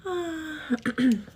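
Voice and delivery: ordinary talk, nothing marked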